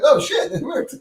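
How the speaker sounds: high, delighted squeak; mock surprise. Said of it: "You're like".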